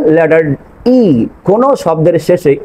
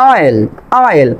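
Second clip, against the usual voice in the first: normal talking voice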